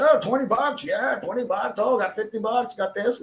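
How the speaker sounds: high, mock-eager streamer voice; sneering impression of a greedy livestreamer; mocking trucker. Mocking a trucker begging viewers for cash, high and eager.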